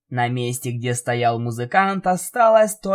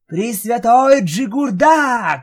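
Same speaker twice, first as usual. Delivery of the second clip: slow, loud, solemn summoning; loud invocation; 9 semitones higher, 6 dB louder, slower than usual. Acted. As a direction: call out the holy name. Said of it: "громко сказал Спайк".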